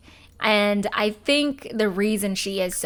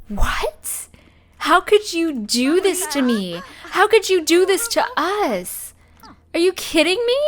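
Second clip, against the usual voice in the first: higher, louder, hurt mock yell at a cheating partner; 8 semitones higher, 4 dB louder than usual. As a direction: play the betrayed wife shouting, but hammed up. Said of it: "So she's gonna yell at her a little bit".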